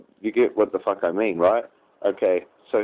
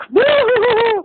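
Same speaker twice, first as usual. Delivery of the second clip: high laugh; 7 dB louder than usual. Real, not acted. Laughing at own story.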